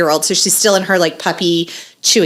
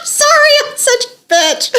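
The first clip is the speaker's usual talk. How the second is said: high, squeaky words through real laughter; cracking up while apologizing; laughing apology. Real, not acted. Mom guiltily laughing at her child's tragedy, helpless laughter.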